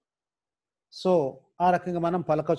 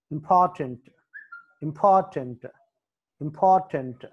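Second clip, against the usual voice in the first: Important with a strong "por"; important, stressed puffed P, falling end; 3 dB louder than usual. Shown as a demonstration. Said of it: "పి దగ్గర stress mark".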